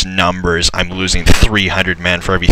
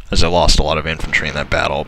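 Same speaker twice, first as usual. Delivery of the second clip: ordinary talk, nothing marked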